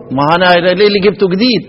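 usual speaking voice

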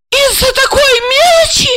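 very high-pitched wife's outburst; outraged cry. A narrator's reading. Wife throwing husband's word back, outraged cry. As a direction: cry out in indignation, far up in pitch. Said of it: "закричала она".

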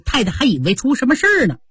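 ordinary delivery, nothing marked

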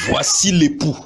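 crying out. Acted. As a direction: call out the arrival to everyone. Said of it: "On cria". (cri d'annonce, voix élevée)

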